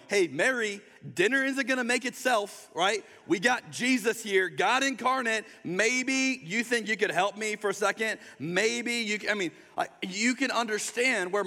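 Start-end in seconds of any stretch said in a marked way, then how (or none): 0.1-9.3 higher, sarcastic nagging; exasperated Martha. Acted. Martha asking Mary for help with dinner, exasperated. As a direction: needle a sister who isn't helping. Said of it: "I can imagine Martha going".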